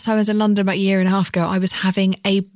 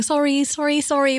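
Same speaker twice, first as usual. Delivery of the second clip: apologetic fan; 8 semitones higher than usual. Retold or imitated. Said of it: "she just kept on saying".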